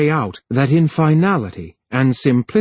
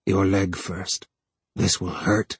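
low voice; 5 semitones lower, 6 dB quieter than usual. A narrator's reading. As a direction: drop the voice to a quiet threat. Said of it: "His voice was low".

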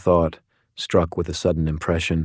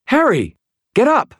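urgent shout; 5 dB louder than usual. A narrator's reading. Murphy shaking him awake.

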